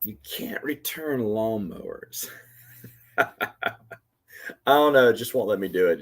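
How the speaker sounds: usual speaking voice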